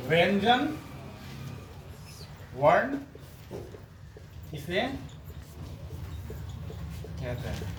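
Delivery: word by word with long pauses. Real, not acted. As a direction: dictate it for students writing it down. Teacher dictating a question heading.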